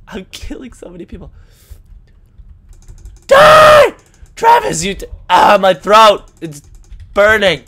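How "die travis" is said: screaming high and loud; loud shout. Real, not acted. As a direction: yell it at the enemy. Gamer attacking, shouting.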